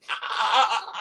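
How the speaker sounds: high broken mock yell; agonised yell. A narrator's reading. Shot man falling to the ground, high mock yell. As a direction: cry out in repeated high bursts. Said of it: "falls to the ground and yells".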